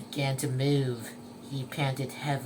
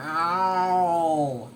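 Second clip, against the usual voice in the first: muttering snail; 4 dB louder than usual. A narrator's reading. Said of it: "muttered Gary".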